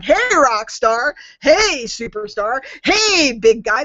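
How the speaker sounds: slow, drawn-out mock greeting; lightly mock a neighbor greeting; gushing hype voice. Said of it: "That's, like, weird".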